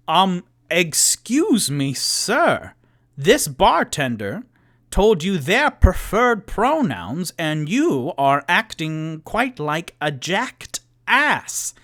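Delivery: loud, swooping, measured indignation; Toot Toot, indignant. Acted. Towering over the dwarf to defend the bartender, loud and indignant. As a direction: scold with exaggerated, emphatic courtesy. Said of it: "stands to his full seven-foot-three-inch".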